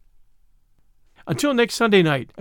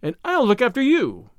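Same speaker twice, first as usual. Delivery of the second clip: high-pitched indignant heroine; high, pointed comeback; resentful retort; 7 semitones higher than usual. Acted. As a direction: throw it back at him, voice raised in pitch. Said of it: "retorted Tuppence, resenting the manly assertion".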